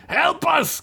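high-pitched cry for help; desperate shout. A narrator's reading. Gunk at the pillar as friend is pulled, desperate shout. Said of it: "Gunk shouted".

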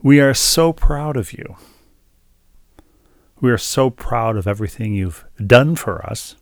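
lightly voiced gushing lady, emphatic; emphatic quote of a proud fan; imitating an admirer. Lady in parking lot praises wife, imitated gushing. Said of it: "she was using the royal we".

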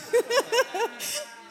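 high bright burst of laughter; joyful laugh. Real, not acted. Finishing her testimony to the church, high delighted laugh.

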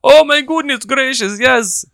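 hammed-up high moan; high mock orgasm voice. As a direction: squeal it high and breathless. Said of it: "You'll be like".

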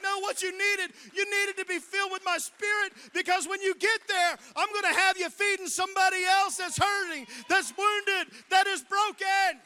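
loud, high preaching voice for God's words; voice of the Lord. Retold or imitated. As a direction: proclaim it as the Lord speaking. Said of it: "The Lord's like".

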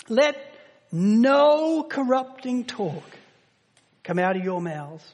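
slow, weighty reading of the verse; solemn measured reading; slow emphatic scripture. Acted. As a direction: lean on each word of the command.

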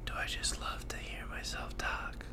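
breathy whisper to the mirror; hushed whispered self-question in the mirror. Retold or imitated. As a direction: whisper it to your own reflection. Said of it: "and be like".

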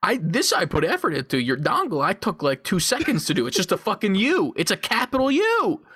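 exasperated rant climbing high in pitch; mock outraged rant. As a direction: build to a squeaky, indignant punchline. Acted comeback to offended model.

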